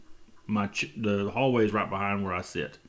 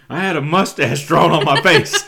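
laughing through words; 6 semitones higher, 11 dB louder than usual. Real, not acted. Retelling son, laughing.

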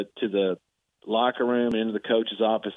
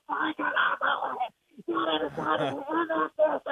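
high, slow, near-crying rage; stunned, tearful fury with drawn-out words; retold berserk outburst; 3 dB quieter, slower than usual. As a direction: replay the coach losing it in disbelief. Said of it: "going just berserk on me".

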